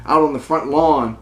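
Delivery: ordinary talk, nothing marked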